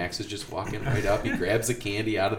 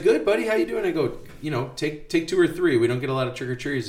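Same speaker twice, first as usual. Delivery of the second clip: high-pitched friendly teacher; bright high voice to a kid; high cheerful voice; 9 semitones higher, 3 dB louder, faster than usual. Retold or imitated. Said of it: "I was like".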